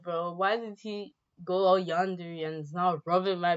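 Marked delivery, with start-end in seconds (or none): none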